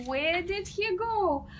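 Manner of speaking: plain reading voice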